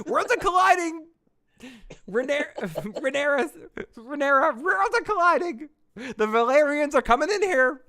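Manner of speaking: high, loud squawky impression; parakeet-like mock panic. Warning Rhaenyra that worlds collide, squawky mock panic. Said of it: "yours came out kinda like a parakeet".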